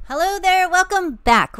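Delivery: big enthusiastic hello; excited loud greeting. Real, not acted. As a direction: belt out the welcome.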